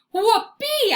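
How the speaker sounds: loud, high whoop; joyful dog whoop; whooping bark. A narrator's reading. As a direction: yelp with glee. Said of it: "I bark".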